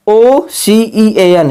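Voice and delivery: very slow, letter by letter; slow spelling out. Shown as a demonstration. Teacher spelling ocean for students, slow and deliberate. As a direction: spell each letter out slowly for the class. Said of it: "जैसे ओशन".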